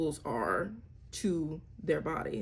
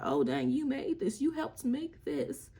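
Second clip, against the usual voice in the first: put-on praising child; 6 semitones higher than usual. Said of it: "Be like".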